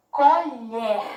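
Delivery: "Colher" loud, with LHER punched; stressed final syllable. Shown as a demonstration. Teacher quizzing pupils on the strong syllable, loud stressed ending of "Colher". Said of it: "falei assim com mais força".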